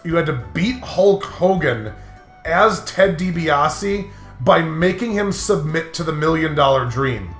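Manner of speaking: ordinary delivery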